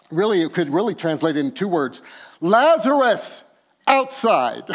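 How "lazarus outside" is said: loud, higher and slow call; loud cry. Acted. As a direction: summon him outside. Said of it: "cries out with a loud voice".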